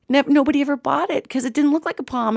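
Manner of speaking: ordinary delivery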